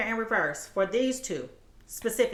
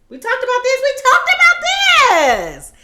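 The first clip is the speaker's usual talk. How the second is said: exasperated shout; 13 semitones higher, 12 dB louder than usual. Acted. Repeats the phrase louder.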